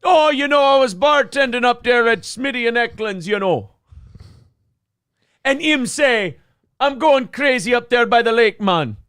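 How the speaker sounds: high, loud Jamaican patois over a Minnesota lilt; Jamaican Fargo accent. Acted. Spinning a bartending yarn in a Jamaican Fargo accent, high and loud. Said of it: "Jamaican Fargo".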